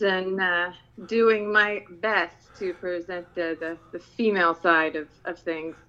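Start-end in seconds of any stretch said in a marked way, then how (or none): none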